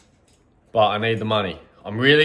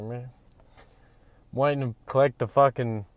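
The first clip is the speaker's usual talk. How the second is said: ordinary talk, nothing marked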